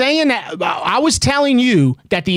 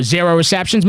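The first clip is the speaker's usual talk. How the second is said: ordinary talk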